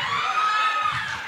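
high squealing burst of laughter; shrieking laugh. Real, not acted. Preacher laughing at the ex-boyfriend story, high shriek.

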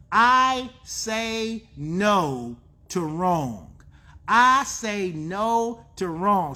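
drawn-out, emphatic declaration; slow declaration. Real, not acted. Preacher leading listeners to declare it, slow and emphatic. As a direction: stretch each word, let it land.

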